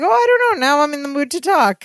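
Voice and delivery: high-pitched coy character voice; Balky. Retold or imitated. Balky stalls, now in the mood to talk, coy. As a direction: stretch it up high, playfully stalling. Acting out Balky refusing to leave.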